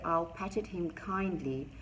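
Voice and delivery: usual speaking voice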